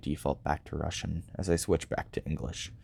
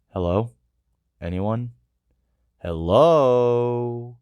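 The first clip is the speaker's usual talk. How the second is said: calling louder into the dark; 6 semitones higher, 8 dB louder, much slower than usual. Acted. Robot calls into the empty void, growing louder. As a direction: call out, louder each time.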